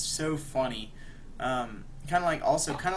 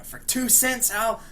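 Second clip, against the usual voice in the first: high, louder mock yell; acted-out angry outburst; retold angry threat; 9 semitones higher, 4 dB louder, slower than usual. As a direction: imitate a driver hurling a threat. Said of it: "yelling at the Joker".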